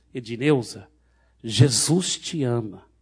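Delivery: very loud proclamation. Retold or imitated. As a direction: declare it slowly for a crowd to hear. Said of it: "Aí eu falei bem alto".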